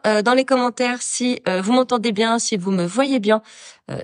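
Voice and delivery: ordinary talk, nothing marked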